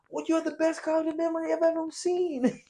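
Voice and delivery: very high squeaky fawning voice; sugary falsetto thanks; mock gushing praise. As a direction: gush like a cartoon fan. Mocking fake praise.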